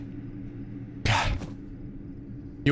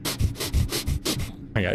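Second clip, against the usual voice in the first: slow, low whispered dread; scared whisper; 8 semitones lower, 4 dB quieter, much slower than usual. Real, not acted. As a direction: breathe it out in terror. Frozen with fear at the jump.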